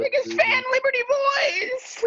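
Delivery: high, slow screaming fan. Pretend. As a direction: scream like a superfan.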